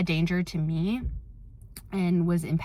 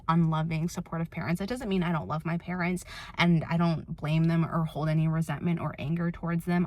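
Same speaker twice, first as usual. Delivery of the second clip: usual speaking voice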